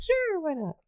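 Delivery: very high-pitched, airy mock reply; playful high voice. Joking about her own accent, playful high-pitched reply.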